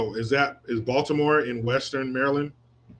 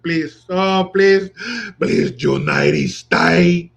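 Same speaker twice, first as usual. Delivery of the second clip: pleading, louder and higher, please stretched slow; hammed-up begging plea; mock begging; 5 semitones higher, 6 dB louder, much slower than usual. Scene: host mocking migrants begging to stay, pleading.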